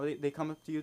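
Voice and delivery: usual speaking voice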